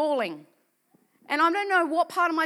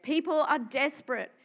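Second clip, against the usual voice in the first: usual speaking voice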